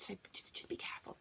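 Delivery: soft warning whisper. Real, not acted. Warns the children gently.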